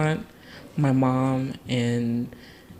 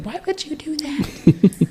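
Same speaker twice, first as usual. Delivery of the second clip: a hint of a high, slow, startled old great-grandma; lightly voiced great-grandma, aghast at the child's face; great-grandma scolding; 14 semitones higher, 4 dB louder, slower than usual. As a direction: let her drag it out high and slow in disbelief. Great-grandma scolding the hurt kid.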